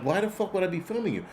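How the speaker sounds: mildly exasperated comeback; annoyed retort. Retold or imitated. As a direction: replay your irritation at being accused, voice lifted in pitch. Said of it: "'cause I was annoyed".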